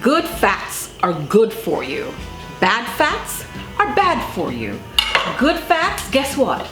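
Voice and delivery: usual speaking voice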